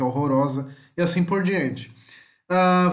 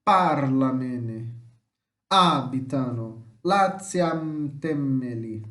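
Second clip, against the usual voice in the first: slow, lower, drawn-out "Parlamene", "Abitano", "Lasciatemeli"; showing the stressed syllable; 4 semitones lower, much slower than usual. Shown as a demonstration. Teacher helping students hit the tonic syllable, showing the stressed syllable. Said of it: "pra você acertar a sílaba tônica".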